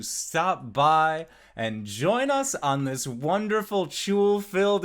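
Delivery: high, loud upbeat stream outro; energetic host. Real, not acted. Host thanking viewers at the end of stream, excited. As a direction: hype up the audience at the close. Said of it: "GM sign-off to stream audience".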